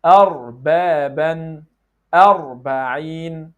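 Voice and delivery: slow, stretched 'Rijalun' and 'Arba'in', careful R; model pronunciation. Shown as a demonstration. Teacher giving examples to repeat, slow careful articulation. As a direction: sound out each word like 'Fir'aun', vowels held long. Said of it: "let's work on some examples".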